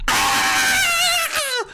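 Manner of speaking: high, loud triumphant yell; a sudden high yell of joy; excited shout. Real, not acted. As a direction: let out a victory scream. Hype shout over the game.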